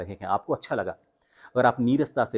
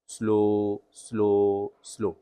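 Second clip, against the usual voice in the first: slow drill; 3 semitones lower, much slower than usual. Shown as a demonstration. Coach demonstrates the slow drill for students. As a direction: stretch each slow slowly. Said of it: "शुरुआत आप इससे करें".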